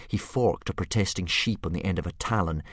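usual speaking voice

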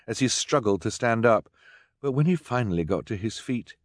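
ordinary delivery, nothing marked

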